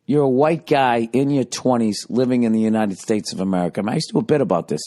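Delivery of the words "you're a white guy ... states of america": normal talking voice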